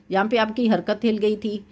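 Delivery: ordinary talk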